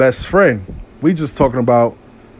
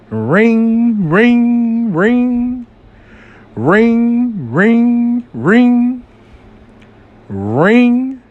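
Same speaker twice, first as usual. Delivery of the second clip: high sung-out ring, ring like a phone; mock ringtone, voiced high; phone ring imitation; 8 semitones higher, much slower than usual. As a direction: mimic the phone ringing, pitched up.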